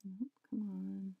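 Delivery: slow, voiceless whisper; tense whispered urging. Real, not acted. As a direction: hiss it under your breath. Frustrated gamer under breath.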